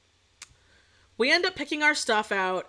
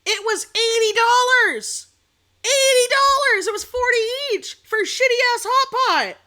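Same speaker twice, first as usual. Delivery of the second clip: 1 outraged shout; 6 semitones higher, 5 dB louder than usual. Real, not acted. Bill outrage.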